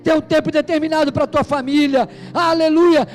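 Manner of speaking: loud, rising preacher's cry; full-force preaching. Acted. Preacher rousing the congregation, full-force fiery preaching. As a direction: preach it out to the whole church.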